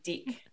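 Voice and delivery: mock Kiwi vowel in "Dick", meaning deck; Kiwi pronunciation. Shown as a demonstration. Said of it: "That's deck for anybody listening".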